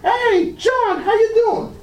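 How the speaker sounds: a passerby calling out to John; friendly greeting. Retold or imitated. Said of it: "Walking down the street".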